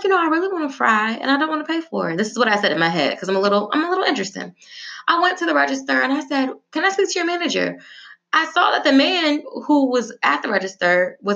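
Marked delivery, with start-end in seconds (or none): 0.0-2.2 mock inner voice. Her inner voice wanting fries. 6.7-7.8 sweet customer voice. Retold or imitated. Politely asking at register.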